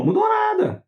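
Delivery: high, squeaky mock reply; mocking squeak. Mocking his audience in a high squeal. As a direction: squeal it out in mockery. Mocking answer to own question.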